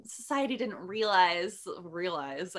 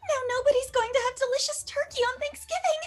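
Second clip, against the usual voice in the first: crying anime princess. Acted. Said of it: "Princess Elizabeth burnt the turkey".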